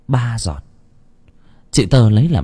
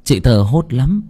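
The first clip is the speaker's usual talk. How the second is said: plain reading voice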